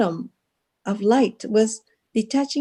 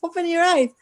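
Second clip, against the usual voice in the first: calling out urgently; 8 semitones higher than usual. Retold or imitated. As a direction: call her back, insistent. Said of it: "She said".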